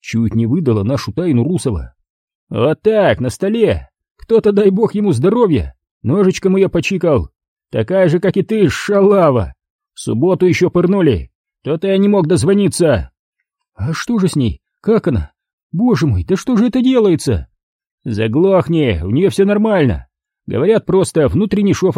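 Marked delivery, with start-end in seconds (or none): 2.5-13.1 high, scornful thug bark; irritated thug. Acted. Thug snaps at the intruder in a high scornful voice. 13.8-17.5 high, anxious woman's voice; distressed woman. Acted. Tatiana anxiously asks how Galina is.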